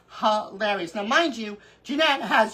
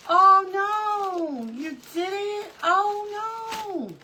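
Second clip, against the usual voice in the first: high, drawn-out wail of dismay; 8 semitones higher, much slower than usual. Real, not acted. Crafter hearing her friend lost her page, high dismay. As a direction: stretch each "oh no" up high in sympathy. Reacting to news of hacked page.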